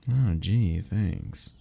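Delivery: normal talking voice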